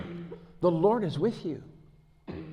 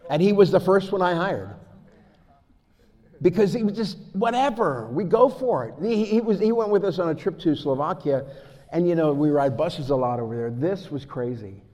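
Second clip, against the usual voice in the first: loud, high, driving sermon delivery; preaching at full force; 4 semitones higher, 9 dB louder than usual. Acted. Preacher rousing his church with stories of bold faith, full-force preaching. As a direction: fire up the congregation.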